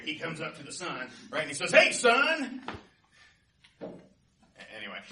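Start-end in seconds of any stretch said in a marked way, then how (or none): 1.7-2.6 booming, high-pitched hail; blustery North Wind. Acted. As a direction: call out loud and high, full of bluster. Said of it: "he's really blustery".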